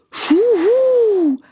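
high owl hoot. Acted. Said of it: "machte der Uhu wieder". (hoher Eulenruf)

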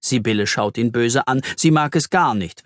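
plain reading voice

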